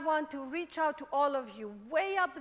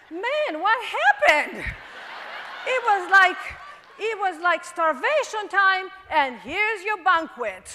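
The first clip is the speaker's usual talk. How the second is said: excited; 3 semitones higher, 8 dB louder than usual. Real, not acted. Excited recounting of success.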